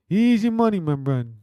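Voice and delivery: high, loud mock Brazilian hype; hyped catchphrase. Streamer hyping his fight pick in mock Brazilian accent.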